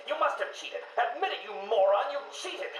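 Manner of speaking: high-pitched accusing yell; angry yelling. Acted. Patient imitating father accusing him of cheating, angry yelling. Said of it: "He kept yelling".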